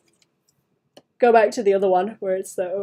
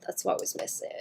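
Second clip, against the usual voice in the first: soft breathy whisper; 9 dB quieter than usual. Shown as a demonstration. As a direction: whisper it to yourself. Whispered then repeated aloud.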